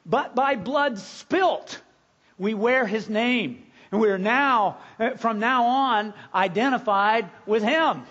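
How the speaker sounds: fervent pulpit delivery; preaching at full force. Acted. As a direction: build each phrase to the pulpit.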